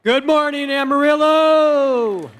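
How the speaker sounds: big, drawn-out arena greeting; fighting game announcer. Real, not acted. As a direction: stretch the city name like a ring announcer. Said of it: "Please welcome [name]".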